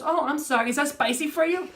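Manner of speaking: high, loud imitated fussing; apologetic technician. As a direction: play the technician gushing an apology.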